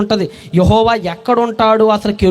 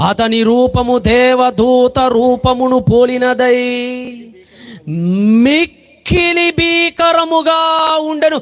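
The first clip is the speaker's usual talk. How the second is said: awed proclamation; much slower than usual. Acted. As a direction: proclaim the terror of the angel. Solemn scripture quote, shout.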